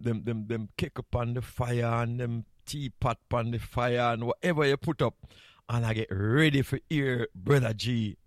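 ordinary delivery

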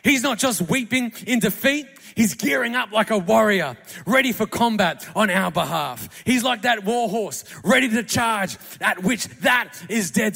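loud, rising pulpit cry; full-force preaching. Acted. Preacher rallying the congregation with Jesus as a war horse, loud and fervent. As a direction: build it like a battle charge.

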